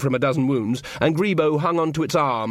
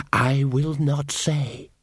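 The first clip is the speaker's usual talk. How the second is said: defiant creature, dragging every word; sinister elf; 2 semitones higher, much slower than usual. Acted. Elf figure voiced.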